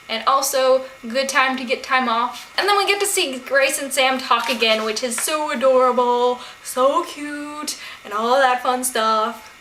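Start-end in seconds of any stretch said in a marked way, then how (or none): 4.8-9.5 a put-on gushing voice, a bit slower at the end; odd gushy voice. Real, not acted. Gushing to viewers about Grace and Sam, a light silly put-on voice. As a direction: gush over the cute couple in a silly voice. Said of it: "I don't know why I'm talking like that".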